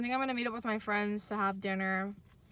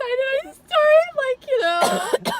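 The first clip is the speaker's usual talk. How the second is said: much higher, louder, slow excitement; excited high voice; 10 dB louder, slower than usual. Shown as a demonstration. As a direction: let the voice jump way up. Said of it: "when I get really excited".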